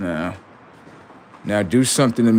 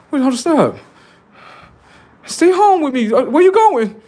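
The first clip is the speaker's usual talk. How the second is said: high, loud, desperate begging; pleading girlfriend; 6 dB louder than usual. Retold or imitated. Girl begging her man not to leave the house, high and loud. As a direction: beg like a clingy girlfriend at the door. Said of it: "it's like a girl".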